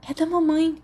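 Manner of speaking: put-on high, bright exclaim of a girl; excited girl. Girl exclaiming over her mother's letter, excited. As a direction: cry out happily at the letter. Tina reads letter from mom.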